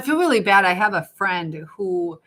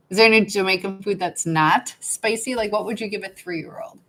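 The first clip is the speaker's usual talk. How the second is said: ordinary delivery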